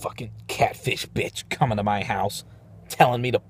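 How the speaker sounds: hushed, unvoiced rant; grumbling to himself about the catfish, whispered. Real, not acted. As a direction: whisper it through clenched anger.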